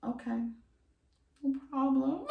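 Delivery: high, small mock-submissive voice; meek compliance. Teller voicing her reply to her bossy lover, meekly compliant. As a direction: give in with a tiny high voice.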